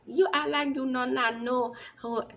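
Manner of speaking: hammed-up Jamaican accent. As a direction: quote her in a mock Jamaican accent. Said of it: "My Jamaican accent".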